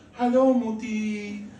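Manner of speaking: put-on high, slow sing-song child voice; drawn-out high-pitched kid greeting; daughter's greeting. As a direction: imitate a little girl calling out hello. Voices daughter greeting her mother.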